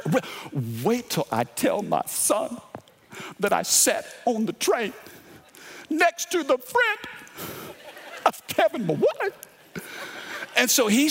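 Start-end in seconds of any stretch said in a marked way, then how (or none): 0.1-9.3 thrilled fan. Retold or imitated. Excited Jets fan on the train, rising high and giddy. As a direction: voice climbing much higher with excitement.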